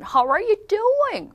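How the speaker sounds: hammed-up, high American "How are you doing"; over-the-top American accent. Shown as a demonstration. Teacher mocks excitable Americans, over-the-top American accent. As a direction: make a big drawn-out American fuss. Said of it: "뭐 난리 났죠?".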